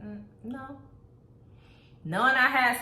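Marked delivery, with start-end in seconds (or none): 0.0-0.8 mumbled no. Retold or imitated. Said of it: "I would probably be like".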